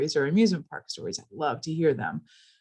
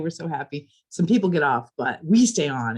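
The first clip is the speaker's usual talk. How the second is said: usual speaking voice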